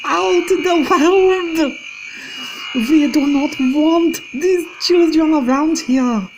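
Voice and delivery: very high, loud, very slow shriek; witch scream. Acted. Grand High Witch denouncing children to witches, screaming. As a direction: scream it out in disgust.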